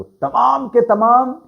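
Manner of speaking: teacher's slow, high emphasis on तमाम; underlining the words. Shown as a demonstration. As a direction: stretch तमाम high to stress every single one. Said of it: "ये मैं underline करवाना चाहता हूं".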